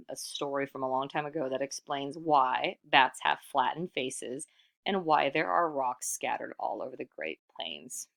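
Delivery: usual speaking voice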